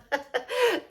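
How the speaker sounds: high burst of laughter; amused laugh at herself; real laugh. Real, not acted. Laughs at self-recording.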